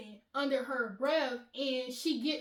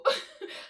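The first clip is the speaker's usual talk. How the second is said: short high burst of laughter; quick high breathy giggle; amused laugh; 11 semitones higher than usual. Real, not acted. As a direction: crack up briefly. Laughs at her mock confrontation.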